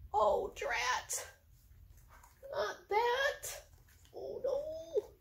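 high put-on squeaky dismay with a laugh; dismayed cat. Voicing the upset cat for her pets, high dismay. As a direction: wail like a cat hating the rain.

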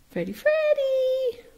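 very high, drawn-out call of the name; calling the bird. Real, not acted. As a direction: sing out to coax him over.

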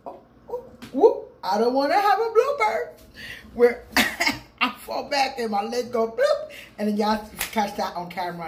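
ordinary talk

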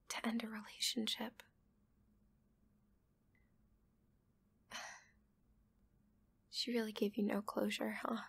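hushed, soothing whisper throughout; ASMR whisper. Acted. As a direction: whisper gently, as if calming someone who is crying.

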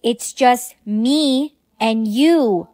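stretched, word-by-word reassurance; slow, deliberate. Acted. Coaxing someone out of earplugs, slow and deliberate. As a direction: say it slowly so it can be understood.

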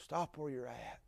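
whispered stop; hushed cry. Acted. Paul stops the jailer, hushed whisper. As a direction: breathe the word stop. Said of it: "He cries".

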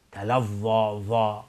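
تلظی with heavy voiced th; dark interdental sound in ظا; correct za'. Shown as a demonstration. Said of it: "نوک دندان پیشانی بالا بر روی".

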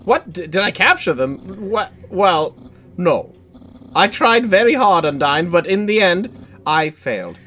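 lightly voiced eager skeleton; a hint of a loud, eager Papyrus.

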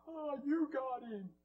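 high, drawn-out mock groan; imitating teammates' taunts. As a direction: jeer it slowly in a high voice. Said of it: "they were all mocking and going".

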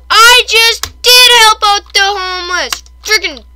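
slow, high, loud protest; indignant yell. Real, not acted. Indignant retort to villager.

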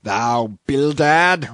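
slow, drawn-out loud roar. A narrator's reading. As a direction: roar the name at him. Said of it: "roared Peleg".

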